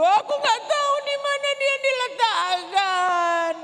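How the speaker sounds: high, slow tearful plea; high slow weeping. Acted. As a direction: still weeping as she speaks. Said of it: "Aku menangis terseduh-seduh".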